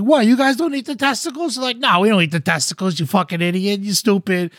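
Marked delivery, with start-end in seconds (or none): 0.0-1.5 high-pitched, incredulous retold question; bewildered protest. Victim asks the laughing villagers, bewildered. Eater reacts to being laughed at. 1.8-4.5 raised-pitch mock taunt; jeering mockery. Villagers laugh at their victim, jeering.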